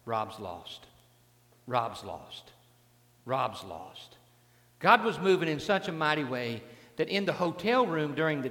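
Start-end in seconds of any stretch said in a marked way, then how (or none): 0.0-4.2 inner voice of God. Retold or imitated. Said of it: "Every time I saw him".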